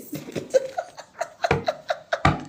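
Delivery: high airy laugh. Real, not acted. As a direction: burst into a laugh. Laughing at herself.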